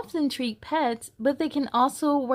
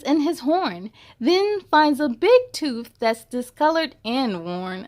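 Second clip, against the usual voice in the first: storybook narration; 3 semitones higher, 5 dB louder than usual. A narrator's reading. Reading a vet story aloud to kids, lively storybook narration. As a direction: read it like a bedtime picture book.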